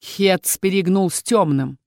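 plain reading voice

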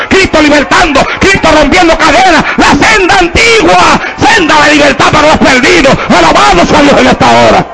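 high, slow, stretched exhortation; drawn-out preaching. Acted. Preacher calling the church to praise God, slow and high. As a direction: lift the praise up slowly.

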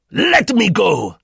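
high, loud, drawn-out yell; furious shout. A narrator's reading. Roux yells at those holding him, furious. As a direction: struggle free, shouting in rage. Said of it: "Roux shouted furiously".

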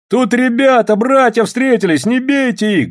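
high-pitched protesting call; urgent appeal. A narrator's reading. Soldiers pleading for their brothers, high urgent appeal. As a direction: appeal to them high and insistent. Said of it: "говорили солдаты".

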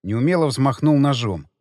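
plain reading voice

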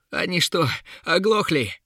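high, exasperated cry; Uril calling out. A narrator's reading. Embattled warrior to guards who cannot hear, high exasperated call. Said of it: "взывал Урил".

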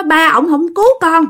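the dead son, through the possessed woman, reproaching his mother about his father; reproachful ghost son. Acted. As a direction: whine a blame at the father, pitched high. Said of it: "Đằng này là trách móc".

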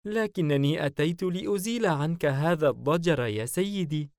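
high, gentle female character voice; soft, sweet voice. A narrator's reading. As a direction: coax the master sweetly, high-pitched. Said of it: "سعلوة بصوت ناعم لطيف". (صوت ناعم لطيف)

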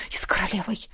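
low, soft addition; hushed promise. A narrator's reading. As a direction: drop almost to a murmur. Tender promise trailing into hush.

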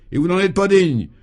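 high, loud retort; irate, scornful reproach; angry contempt. A narrator's reading. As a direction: throw the honour back in his face. Governor scorns the minister.

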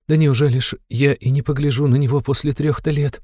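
high, plaintive mother's voice; tearful. A narrator's reading. Mother begs to look at her long-absent son, tearful. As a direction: plead as if about to cry, pitched high. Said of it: "заплакала Пульхерия Александровна".

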